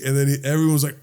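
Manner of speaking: ordinary delivery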